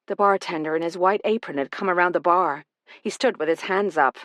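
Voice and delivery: usual speaking voice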